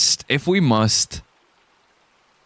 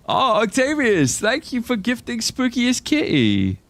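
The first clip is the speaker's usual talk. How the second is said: high sing-song thanks; 10 semitones higher than usual. Pretend. Thanking gifter in put-on voice.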